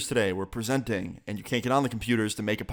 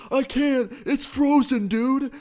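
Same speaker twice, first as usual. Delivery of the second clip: much higher, louder panicked whine; 5 dB louder, slower than usual. Retold or imitated. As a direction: voice the friend freaking out. Friend panics, laptop frozen.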